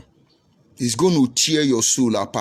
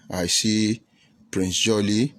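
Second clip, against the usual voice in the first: ordinary talk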